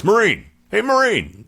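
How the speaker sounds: very high, loud repeated hail; insistent calling. Retold or imitated.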